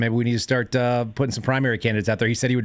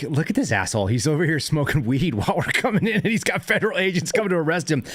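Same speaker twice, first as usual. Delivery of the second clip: higher-pitched amused disbelief, climbing toward the end; retold incredulity; 6 semitones higher than usual. Officer marvelling at a suspect smoking weed as agents arrive, incredulous. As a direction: recount it incredulously, a laugh creeping in.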